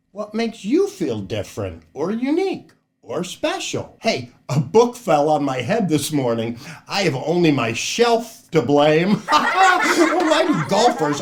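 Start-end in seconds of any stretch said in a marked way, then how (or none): none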